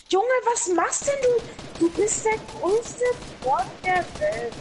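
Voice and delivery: high angry yell. Real, not acted. Raging at teammate mid-game.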